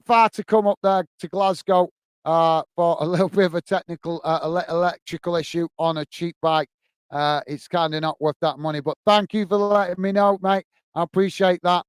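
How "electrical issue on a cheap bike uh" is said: normal talking voice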